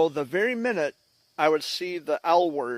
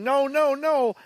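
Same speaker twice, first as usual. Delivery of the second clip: high, loud, repeated refusal; retold screaming; 10 semitones higher, 4 dB louder, slower than usual. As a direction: mimic a child screaming in protest. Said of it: "the whole time screaming".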